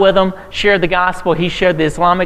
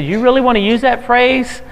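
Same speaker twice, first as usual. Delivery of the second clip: higher-pitched incredulous question; freaked-out publisher; 9 semitones higher than usual. Retold or imitated. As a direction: mimic a publisher balking in disbelief. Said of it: "my publisher freaked out".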